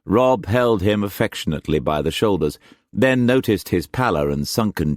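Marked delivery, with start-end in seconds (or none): none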